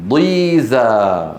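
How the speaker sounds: ضيزى with a long, slow, fully stretched final vowel; drawn-out Quranic reading. Shown as a demonstration. Teacher showing his class how ضيزى is read, drawn out. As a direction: stretch ضيزى slowly to show the kasra. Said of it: "شايفين؟ مكسور قوي".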